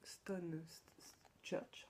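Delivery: slow, whispered try at an English accent; mock-proud English accent, read slowly under her breath; English accent attempt. Real, not acted. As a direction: sound out the English title in a careful, hushed English accent. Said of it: "mon accent anglais déchire".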